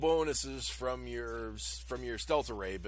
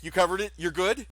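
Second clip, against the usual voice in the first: anxious check; 7 semitones higher, 8 dB louder than usual. Acted. Nock nervously checking with crew.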